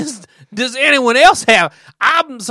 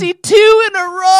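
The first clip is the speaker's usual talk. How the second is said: boastful fan shout; 7 semitones higher, 4 dB louder than usual. Pretend.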